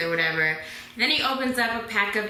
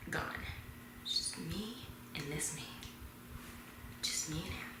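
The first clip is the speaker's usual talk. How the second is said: very soft, lower, lingering near-whisper; hushed awe; 4 semitones lower, 18 dB quieter than usual. Acted. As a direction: savor it in a secretive hush. Alone with the star.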